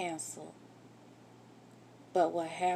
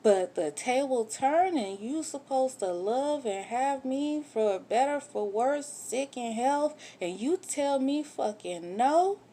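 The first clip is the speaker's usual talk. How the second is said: indignant; 6 semitones higher, 4 dB louder than usual. Real, not acted. Indignant rant at husband.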